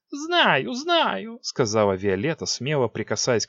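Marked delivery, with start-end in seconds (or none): 0.1-1.4 put-on high impatient girl; impatient queen. A narrator's reading. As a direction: brush off the teacher.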